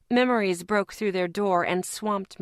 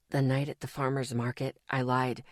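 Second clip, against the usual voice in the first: guilty, quiet confession; 6 semitones lower, 4 dB quieter than usual. A narrator's reading. Guilty confession, low and soft.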